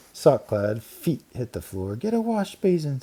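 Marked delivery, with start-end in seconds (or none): 1.9-3.0 put-on high yelling girl voice. A narrator's reading. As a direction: call for the basin. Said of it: "someone yelled".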